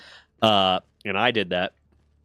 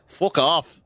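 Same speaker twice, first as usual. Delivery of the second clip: higher, hammed-up British fuck off; mock British accent; 5 semitones higher than usual. Mocking how Brits swear, mock British accent. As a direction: toss it off like a cheeky Brit. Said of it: "the Brits say twat".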